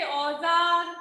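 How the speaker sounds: औजार said slowly with a z, auzaar; औजार with z, drawn out; slow nukta demo. Shown as a demonstration. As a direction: stretch औजार and voice its z. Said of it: "औजार में भी बिंदी लगती है".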